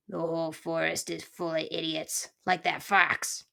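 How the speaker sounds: lightly voiced old grump; a touch of disgusted muttering; grumbling porcupine. Porcupine complaining about forest idiots, mild grumble.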